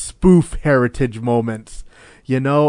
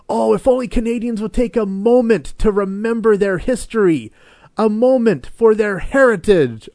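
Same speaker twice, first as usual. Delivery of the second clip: high, earnest mock-solemn voice; wistful mock-sincere plea, pitched up; solemn heritage narrator; 8 semitones higher, 3 dB louder than usual. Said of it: "the best one still has to be".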